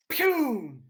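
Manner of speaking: loud, high vocal "pew" zap; imitated lightning blast. Game master voices the lightning bolt, loud imitated zap.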